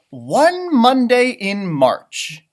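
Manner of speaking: slow, lilting read-aloud; storybook reading. A narrator's reading. Teacher reading a picture book to kids, slow and lilting.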